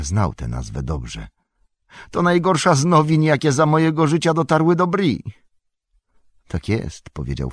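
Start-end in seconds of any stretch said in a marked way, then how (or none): 2.1-5.2 high, loud lament; aghast. A narrator's reading. Innkeeper on the worst news of his life, aghast. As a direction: wail the bad news in dismay.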